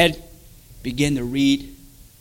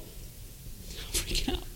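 slow, voiceless whisper; spent whisper; 16 dB quieter, much slower than usual. Retold or imitated. Man breaking down under torment, whispering slowly. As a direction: whisper it as if worn out.

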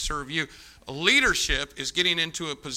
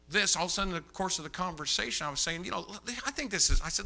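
usual speaking voice

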